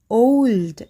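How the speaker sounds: ఓల్డ్ with a gliding au vowel; correct diphthong. Shown as a demonstration. Teacher repeating the right ఓల్డ్ for the class, gliding. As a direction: glide the vowel of ఓల్డ్ toward u. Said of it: "ఔ అనే sound రావాలన్నమాట".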